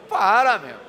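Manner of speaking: sharp, loud "Para"; mock scolding shout to gossipers; stop!. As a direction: cut them off with a loud command. Preacher calls out to stop.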